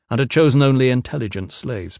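ordinary delivery, nothing marked

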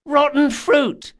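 put-on high, slow child's yell. A narrator's reading. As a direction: yell it like a small boy. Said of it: "the little boy ... yelled".

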